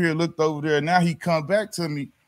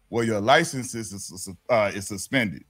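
low, hesitant imitation of an officer; police officer; 9 semitones lower, 4 dB quieter than usual. Officer telling driver about his license, low and halting. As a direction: drop the pitch for the cop's line.

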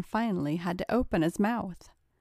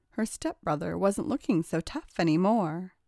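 plain reading voice

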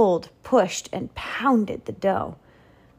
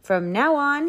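grand royal proclamation; declaring; 6 semitones higher, 5 dB louder than usual. Acted. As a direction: announce it like a decree. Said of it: "he declared".

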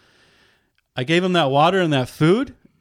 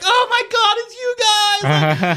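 very high, hammed-up wailing relief; overjoyed dog voice. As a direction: gush like a dog who suddenly recognises friends, squealing high. Dog voiced greeting recognised friends.

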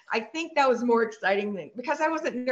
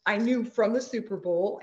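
usual speaking voice

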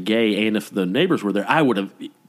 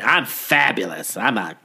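a hint of a campy diva, lightly voiced; lightly mocking fabulous queen voice; mock bitchy queen. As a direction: tease it like a self-declared fabulous queen.

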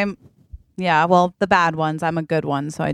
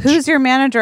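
demanding; 6 semitones higher, 4 dB louder, faster than usual. Acted. Customer demands the manager.

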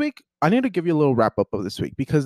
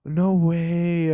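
gasp, then drawn-out higher words; amazed disbelief; 4 semitones higher than usual. Real, not acted. Learning the author is from Guadalajara, amazed disbelief. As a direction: gasp and marvel at it.

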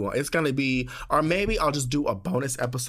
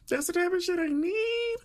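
much higher, a stretched emotional whine; yearning cry. Real, not acted. Longing for a man who takes care of her, a high tearful whine. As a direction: pitch it way up like you're welling up. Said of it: "really crying".

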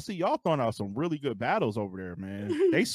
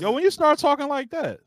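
1 incredulous; 12 semitones higher than usual. Real, not acted. Mock outrage at Stacy.

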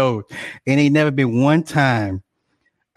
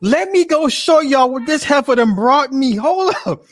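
much higher and louder, hyped; excited; 13 semitones higher, 5 dB louder than usual. Real, not acted. Hyped streamer showing chat what wife brought, high and loud. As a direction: rush to show the chat the snack.

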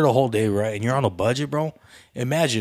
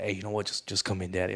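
hushed; 5 semitones lower, 11 dB quieter than usual. Retold or imitated. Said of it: "They're like".

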